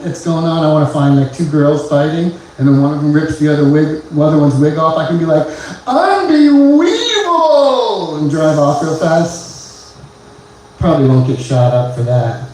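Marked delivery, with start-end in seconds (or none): 5.9-8.2 mock shout. Comedic exclaim while driving off.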